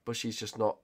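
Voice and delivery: usual speaking voice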